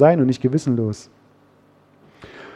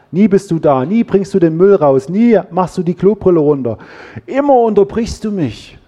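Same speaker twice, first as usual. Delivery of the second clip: higher, emphatic nagging reproach; mock accusing; 3 semitones higher than usual. Preacher acting out a partner's "you never" complaints, accusing. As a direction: fire off mock reproaches, pitch climbing at the end.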